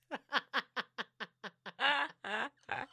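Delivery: a burst of high laughter; laughing. Real, not acted. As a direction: let the laugh run out with a rise. Laughing at digress joke.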